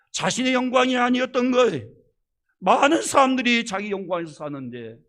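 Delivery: full-force preaching. Acted. Preacher exhorting the congregation, loud impassioned preaching. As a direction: drive the point home to the congregation.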